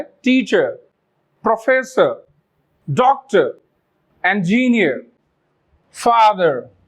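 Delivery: each word ends in uh with no r; non-rhotic endings. Shown as a demonstration. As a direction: drop the final r on every word. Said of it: "टीचर नहीं कहा मैंने".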